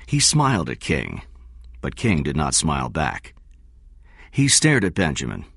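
plain reading voice

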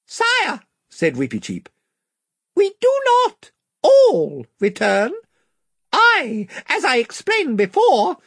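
very high, courtly little mouse voice; a tiny knight standing firm before his king; squeaky valiant mouse. A narrator's reading. Reepicheep the mouse speaking.

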